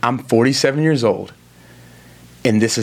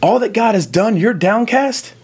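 much higher, disbelieving "Downcast? You?"; incredulous question; 11 semitones higher, slower than usual. Shown as a demonstration. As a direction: ask it as if you can't believe it. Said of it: "I read downcast as a question".

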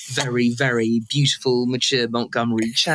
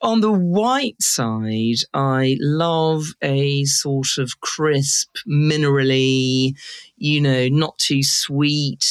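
savouring slowly; much slower than usual. Real, not acted. Savouring wine description.

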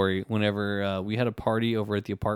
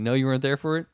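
ordinary delivery

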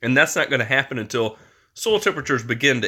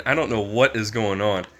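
ordinary talk

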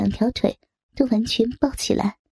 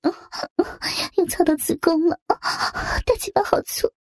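moaning woman; 6 semitones higher than usual. Acted. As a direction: gasp the words out in pleasure.

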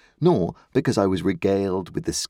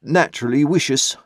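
high, loud terse verdict; terse old man; 8 semitones higher, 5 dB louder than usual. A narrator's reading. Mr Hubble tersely answering the company, high and loud. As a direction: snap out the verdict. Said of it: "tersely solved it".

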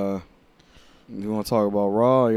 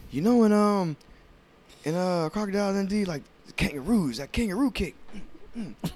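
high, fast goofy pitch; excited goofy partner; 10 semitones higher, faster than usual. Retold or imitated. Pitching the kangaroo kick to his partner, high goofy excitement. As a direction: pitch it like a silly excited sidekick. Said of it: "they were like".

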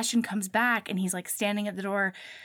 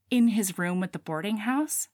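ordinary talk, nothing marked

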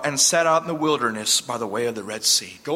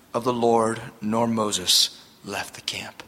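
whispered reading; 8 semitones lower, slower than usual. Acted. Scripture line dropped to whisper.

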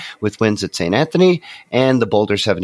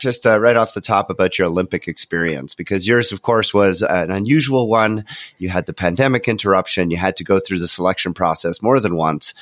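ordinary talk, nothing marked

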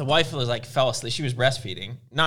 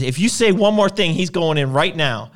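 louder retold threat, slowing at the end; mock ultimatum; 4 semitones higher, 5 dB louder than usual. Husband warning wife before throwing baby, mock angry. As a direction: imitate a husband's last warning, starting high.

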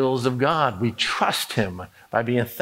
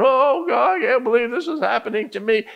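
high, whiny moan of self-pity; hammed-up self-pitying wail to God; moaning complaint; 10 semitones higher than usual. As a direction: whine like someone sorry for themselves. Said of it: "no reason to moan around".

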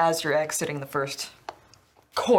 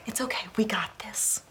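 soft, half-whispered encouragement; quietly steadying a nervous partner; hushed reassurance; 3 semitones higher, 6 dB quieter than usual. Acted. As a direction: reassure your horse under your breath. Quiet reassurance to her horse.

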